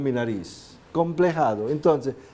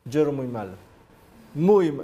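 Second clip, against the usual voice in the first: normal talking voice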